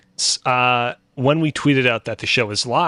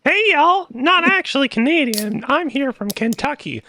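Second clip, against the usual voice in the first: high, hammed-up Southern voice; fake Kentucky accent; 13 semitones higher than usual. Guest introducing himself in a fake Kentucky accent. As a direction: play a Kentucky yokel for the intro. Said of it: "not really a Canadian".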